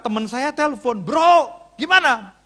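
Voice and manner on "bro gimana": higher, louder 'bro' hailing; acted phone call. Pastor acting a friend's phone call, hailing.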